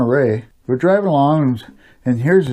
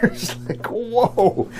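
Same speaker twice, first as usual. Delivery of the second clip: laughing whoa; 8 semitones higher, 4 dB quieter, much slower than usual. Real, not acted. Amazed by a stranger's eyes.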